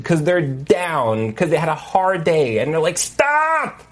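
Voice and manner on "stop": high, sudden mock shout; frustrated parent's yell. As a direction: snap it like a parent at wit's end. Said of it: "they yell at their children".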